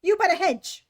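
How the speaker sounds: high, loud scream; screaming rabbit. A narrator's reading. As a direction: scream the order. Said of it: "the rabbit's voice screamed".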